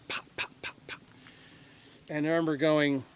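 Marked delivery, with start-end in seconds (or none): 0.1-1.0 soft unvoiced pop-pop-pop like distant gunshots; imitated gunfire pops. Recalling gunfire below, mimics soft gunshot pops. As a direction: breathe out quiet popping gunfire. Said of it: "sound just like a firecracker".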